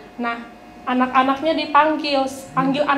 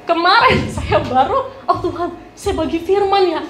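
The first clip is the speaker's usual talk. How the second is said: higher, amused voice with real laughter; laughing while talking; 4 semitones higher, 3 dB louder than usual. Real, not acted. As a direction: laugh through the admission. Menertawakan kesibukannya sendiri sebelum khotbah. (bicara sambil tertawa)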